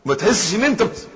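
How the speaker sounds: ordinary talk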